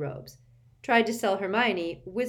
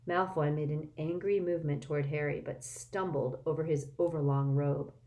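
plain reading voice

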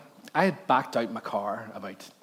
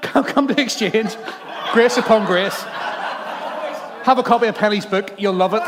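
mock preachy welcome; 8 semitones higher, 9 dB louder than usual. Pastor offering the man a book, hammed-up preachy. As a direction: ham up the holy greeter. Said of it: "I rolled down the window and I went".

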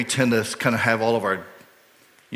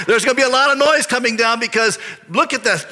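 raised, high-pitched hype; mock-impressed, hyped-up crowd member; excited onlooker; 9 semitones higher, 4 dB louder than usual. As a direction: exclaim like a bystander awaiting a big show. Voices onlookers excited at a rich giver.

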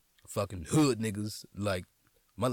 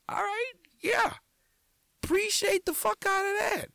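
very high falsetto, half-embarrassed thanks; mock-sheepish high-pitched yes to a fan; squeaky high reply. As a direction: play yourself flustered in a much higher squeak.

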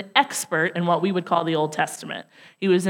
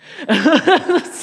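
a short, high, louder laugh; real laugh; 9 semitones higher, 5 dB louder than usual. Real, not acted. Preacher laughing as the cop walks away, a real laugh. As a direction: laugh at her own failure.

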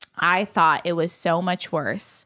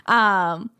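ordinary talk

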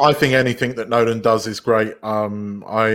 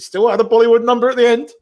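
playful reveal; 10 semitones higher, 5 dB louder than usual. Real, not acted. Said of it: "Oh, God. laughs".

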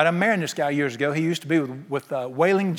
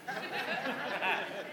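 soft laugh; 10 semitones higher, 13 dB quieter than usual. Real, not acted. Preacher laughs at own joke.

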